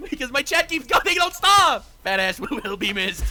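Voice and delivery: high, loud protest through laughter; laughing uncontrollably. Real, not acted. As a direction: blame others while cracking up loudly. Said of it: "I don't know why this is so funny".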